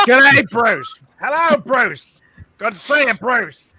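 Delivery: high, lively mock-Australian greeting; Australian accent. Joking about Australians all named Bruce, mock Australian accent. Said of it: "if that person's name is Bruce, you're an Australian".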